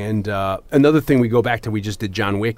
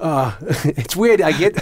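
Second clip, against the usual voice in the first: laughing; 5 semitones higher, slower than usual. Real, not acted.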